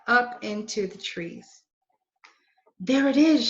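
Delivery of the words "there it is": higher, louder, pleased find; girl spotting the treehouse, excited; excited discovery. Acted. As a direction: point it out with a thrill. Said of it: "she said".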